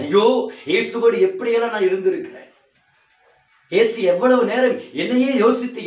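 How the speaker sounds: high, loud fiery sermon; preaching at full force. Acted. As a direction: thunder the warning at the congregation. Preaching Peter's lament. (உரத்த பிரசங்கக் குரல்)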